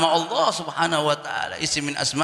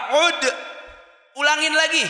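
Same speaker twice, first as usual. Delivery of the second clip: higher, louder call to repeat; raised voice urging him to say it again; Prophet's call; 11 semitones higher, 5 dB louder than usual. Retold or imitated. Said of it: "Nabi bilang apa".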